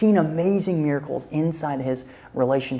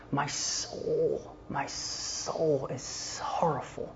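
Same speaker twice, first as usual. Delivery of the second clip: slow, softer, grieving whisper; sorrowful whisper; 9 dB quieter, much slower than usual. Retold or imitated. Jesus telling his disciples of his grief, whispered slowly. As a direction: breathe it out in sorrow. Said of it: "he says something so fascinating".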